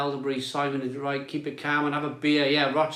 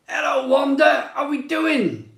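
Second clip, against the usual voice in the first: calling out; 11 semitones higher, 4 dB louder than usual. Real, not acted. Host calling out a hello to Wanda in chat. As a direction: hail her like across the bar. Calls out greeting to viewer.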